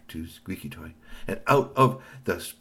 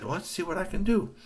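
put-on high boy voice; 10 semitones higher than usual. A narrator's reading.

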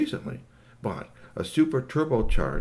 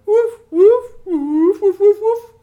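loud, high-pitched imitated woofing; yappy, piercing mock barking; high dog barks; 12 dB louder, slower than usual. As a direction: bark like an excited little dog. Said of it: "And Maxie went".

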